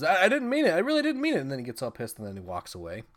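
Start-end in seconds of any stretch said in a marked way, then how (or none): 0.0-1.4 high, loud, hammed-up protest; mock mad. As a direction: blurt it out as an indignant excuse. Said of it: "So he gets all mad and says".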